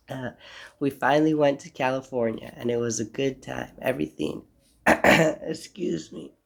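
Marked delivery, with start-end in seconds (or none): 4.9-6.3 a real throat clear, then a slow, higher and louder excuse me. Real, not acted. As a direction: clear the throat and apologise slowly. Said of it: "I just had to clear my throat".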